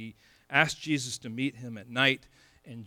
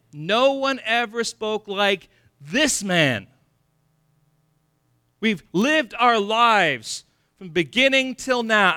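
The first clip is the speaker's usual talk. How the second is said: preaching at full force; 8 semitones higher, 8 dB louder than usual. Acted. Preacher pressing the congregation, preaching at full force. Preaching climax about the officers.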